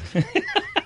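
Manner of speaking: shrill prehistoric bird screech; condor screech. Pretend. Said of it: "That is the sound condors make".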